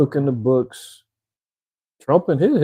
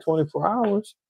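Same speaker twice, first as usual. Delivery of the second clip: higher, incredulous echo; incredulous customer; 7 semitones higher than usual. Acted. Bank customer reacting to an outage, incredulous. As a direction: question it in mock disbelief.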